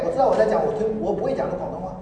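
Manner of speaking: ordinary talk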